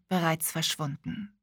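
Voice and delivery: usual speaking voice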